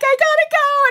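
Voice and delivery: high, loud, rushed brush-off; breathless hurry. Retold or imitated. Rushing past a teammate at O'Hare, high loud hurried reply. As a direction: blurt it out mid-sprint. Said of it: "and I'm like".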